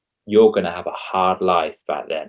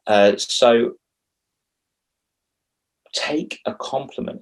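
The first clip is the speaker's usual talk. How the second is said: ordinary talk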